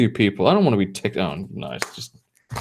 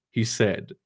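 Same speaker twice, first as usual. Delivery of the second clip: usual speaking voice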